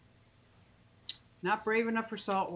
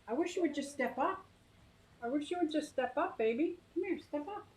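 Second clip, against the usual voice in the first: pet talk; 9 semitones higher than usual. Real, not acted. Coaxing pet bird onto hand.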